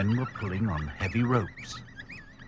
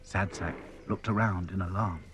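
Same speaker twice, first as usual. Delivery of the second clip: usual speaking voice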